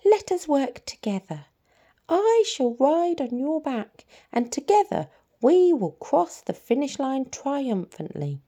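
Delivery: high, scheming little rat; sly rat voice. Acted. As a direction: coax it like a crafty rodent. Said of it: "said the rat with a sly grin".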